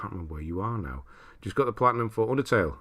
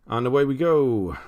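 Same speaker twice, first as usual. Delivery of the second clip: loud, higher send-off with a long last word; launching call; 6 semitones higher, 9 dB louder than usual. Real, not acted. Streamer starts the spin for viewers, loud launching call.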